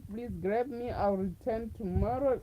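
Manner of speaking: usual speaking voice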